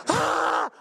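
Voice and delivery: very high, loud mock scream; imitated terrified scream. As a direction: imitate girls screaming bloody murder. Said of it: "They're screaming bloody murder".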